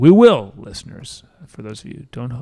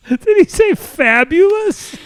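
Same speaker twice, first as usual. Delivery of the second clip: laughing disbelief; 12 semitones higher, 8 dB louder, slower than usual. Real, not acted.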